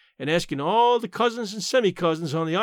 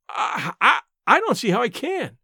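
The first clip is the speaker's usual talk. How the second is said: flustered, higher-pitched stammer; gasping; 6 semitones higher, 3 dB louder than usual. A narrator's reading. Niece told to marry at once, gasping protest. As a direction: catch at the first word, overwhelmed. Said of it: "I gasped".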